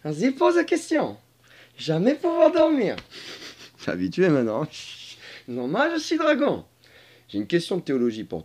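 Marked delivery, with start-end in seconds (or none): 0.0-3.0 high put-on voice, grumbling; high-pitched dragon kept from sleep; comic dragon character. Dragon figure answers, complaining. 5.5-6.7 high put-on creature voice; high-pitched matter-of-fact dragon; comic dragon character. Speaks as the dragon.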